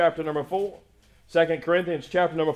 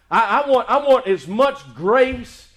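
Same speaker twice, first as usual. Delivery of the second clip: loud rhythmic preaching, punching each thank you; soaring sermon delivery; full-force preaching; 4 semitones higher, 7 dB louder than usual. Acted. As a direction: preach it out to the congregation. Building preaching climax on grace.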